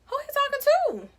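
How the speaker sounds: very high-pitched, indignant disbelief; incredulous reaction. Retold or imitated. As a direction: squeal it in mock outrage. Indignant reaction to dad's words.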